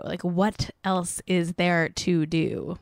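"what else is there to do" stretched out slowly; slow, drawn-out repeat. Shown as a demonstration. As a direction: drag the rhetorical question out, deadpan.

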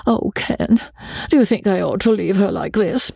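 a daughter torn at leaving, voice catching; tearful daughter. Acted. Kim asking Ken through tears.

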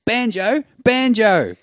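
put-on loud, high call after a runaway kid; yelling a name. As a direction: shout the name like a mum chasing her child toward the road. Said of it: "she yells out".

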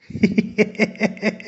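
a high, giggling burst of laughter; bursting out laughing. A narrator's reading. Mom laughs at dad holding sandpaper upside down, high giggle. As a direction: mom can't hold back her laugh. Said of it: "ती हसत सुटली".

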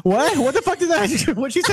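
high, laughing imitation of a stunned mom; laughing mock shock. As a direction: squeal through laughter as the startled mother. Mother in labour shocked, laughing.

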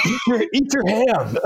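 Napoleon Dynamite. Retold or imitated. Officer mimicking his driver for laughs, high nerdy voice. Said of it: "he talked like Napoleon Dynamite".

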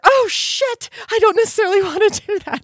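hammed-up oh-no moment; later regret. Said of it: "and then later".

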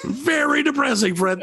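"Very depressing" pitched high and drawn out; mock-dismayed emphasis. Real, not acted. Host scolding Fred's grim facts, "Very depressing" high and drawn out. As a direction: stretch "Very depressing" slowly, way up high.

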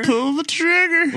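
slow, high, drawn-out order; hammed-up command to pull it; dramatic command. To Luke, a slow, high mock command.

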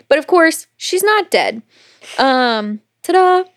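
high, bright ta-da flourish; spring the surprise like a magician; playful reveal. Real, not acted. She tells her friend the twist, high and playful. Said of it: "reveal with a flourish".

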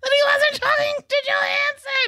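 very high, squealing through laughter; words squeezed out high through laughs; real helpless laughter. Real, not acted. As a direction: struggle to finish while cracking up. Reader cracks up mid-trivia.

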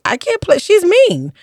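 high-pitched dismayed objection; playfully distressed refusal; retold protest. Retelling her protest at the role.

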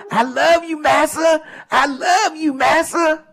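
very high, pleading mock devotion; mocking fawning servant. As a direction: gush it up high like a groveling servant. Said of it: "He might as well have said".

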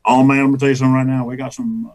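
fast mumbled [name] patter. Acted. Comic tells listeners the lunch menu, mumbled [name]. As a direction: run the words together like a comic [name]. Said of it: "I would do [name]".

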